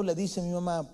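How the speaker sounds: usual speaking voice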